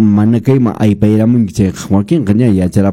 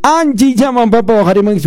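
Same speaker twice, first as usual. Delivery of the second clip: eager call out; 11 semitones higher, faster than usual. Acted. Urging listeners to answer calls.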